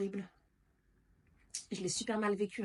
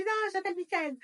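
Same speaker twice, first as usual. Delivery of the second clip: panicked plea; 12 semitones higher, 4 dB louder than usual. Retold or imitated. Retelling her panicked reply.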